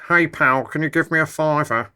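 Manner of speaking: high, put-on pal voice; mocked modern speaker. Asking a pal for money, high mocking modern voice. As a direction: caricature today's way of asking, pitched well up. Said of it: "But now it's".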